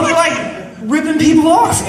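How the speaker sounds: high, indignant, almost shouted; retelling the scam with high-pitched indignation; outraged. Retold or imitated. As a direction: exclaim it in amused outrage.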